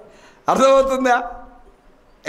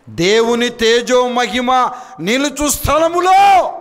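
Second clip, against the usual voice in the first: high, loud, slow shouted phrase; fervent preacher proclaiming the holy place; preaching shout; 6 semitones higher, 5 dB louder, much slower than usual. Acted. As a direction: shout it with full fervour.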